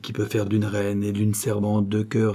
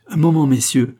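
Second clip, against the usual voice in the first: louder, higher call to hold on; raised, commanding call; 5 semitones higher, 6 dB louder than usual. A narrator's reading. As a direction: call briskly to the men to wait. Queen halts the two officers.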